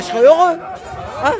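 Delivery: usual speaking voice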